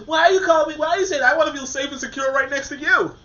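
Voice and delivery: mock indignant demand. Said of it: "And you saying".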